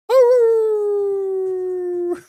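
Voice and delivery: high squeaky dog yelp imitation; mock high-pitched whimper bark; imitating dog's yelp. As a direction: yelp like a small dog, not a big one. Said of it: "That's what he sounds like".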